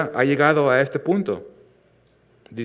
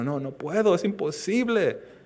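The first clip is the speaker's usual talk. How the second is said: put-on whiny baby; 6 semitones higher than usual. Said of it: "como el bebé llorando".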